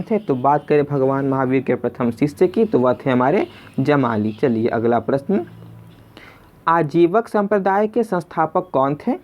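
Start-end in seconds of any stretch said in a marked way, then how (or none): none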